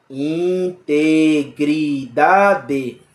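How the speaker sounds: "Integridade" drawn out very slowly, in-te-gri-da-de, every syllable apart; split into syllables. Shown as a demonstration. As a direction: stretch "Integridade" into five slow, separate syllables. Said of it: "Quantas sílabas?". ("Integridade" bem devagar, sílaba por sílaba)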